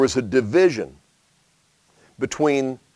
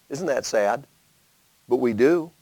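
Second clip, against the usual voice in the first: ordinary delivery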